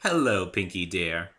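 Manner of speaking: slow, gracious and lightly refined; refined Rarity. Acted. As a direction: greet her like a gracious lady.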